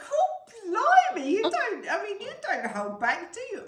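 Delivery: high, loud burst of mock astonishment; scandalised squawk, pitched right up; shocked exclamation. Friend reacts to a cheeky offer from the captain, shocked. Retelling her startled reply to a suggestion.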